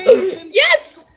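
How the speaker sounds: gasp, then a high, loud yelp of yes; snatch the offer with a gasping yes; excited yes. Retold or imitated. Accepting a friend's offered kitten, a gasping excited yes. Instant eager answer to buddy.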